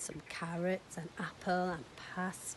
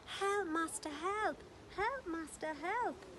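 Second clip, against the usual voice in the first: high calling cry for help; harp shouting; 11 semitones higher, slower than usual. Acted. Magic harp crying to its master for help. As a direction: call out like a frantic harp. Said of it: "the harp began to shout".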